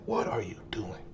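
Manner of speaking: unvoiced whisper to himself. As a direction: hiss the question at yourself in disbelief. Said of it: "I'm like".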